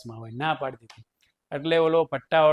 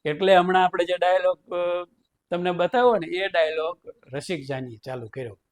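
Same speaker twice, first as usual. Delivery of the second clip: ordinary delivery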